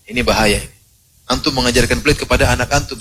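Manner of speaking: ordinary talk, nothing marked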